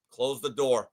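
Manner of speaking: low, quiet, final order; stern coach repeated. Retold or imitated. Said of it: "And Jimmy goes".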